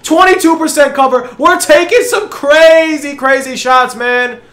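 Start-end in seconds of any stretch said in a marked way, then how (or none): none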